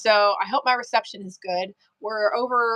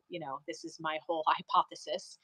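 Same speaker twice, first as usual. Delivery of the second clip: usual speaking voice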